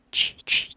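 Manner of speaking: G whispered with no voice; just mouth the vowel of G; breathed G; voiceless G. Shown as a demonstration. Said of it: "입모양만 해주고 발음을 내지 않아요".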